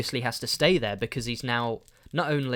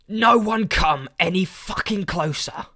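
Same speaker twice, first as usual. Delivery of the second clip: higher, louder menacing yell; angry shout; 7 semitones higher, 7 dB louder than usual. Retold or imitated. Angry man shouting at an elderly woman near the doors, menacing yell. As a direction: bark it at the frail old woman. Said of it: "he was shouting".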